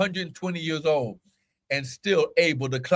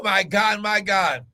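ordinary delivery

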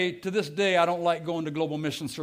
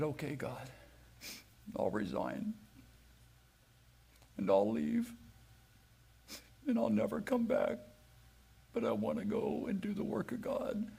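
near-whispered, softer, tearful prayer of giving in; hushed surrender; 2 semitones higher, 11 dB quieter than usual. Retold or imitated. Preacher retelling his prayer to God, hushed and tearful. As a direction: barely breathe the words, broken and yielding.

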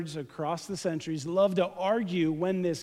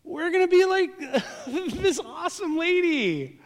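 laughing; 10 semitones higher, 6 dB louder than usual. Real, not acted. Laughs at the cheering lady.

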